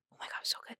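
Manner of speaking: hushed whisper. Real, not acted. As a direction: breathe it out in a whisper. Excited whisper about the ham.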